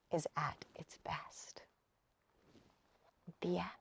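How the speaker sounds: soft whispered story ending. A narrator's reading. Closing line of bedtime story.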